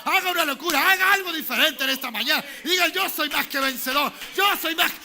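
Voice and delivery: shouted, high-pitched rallying declaration; full-force preaching. Real, not acted. Preacher urging congregation to declare victory over the devil, full-force shout. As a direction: rouse the whole church at the top of your voice.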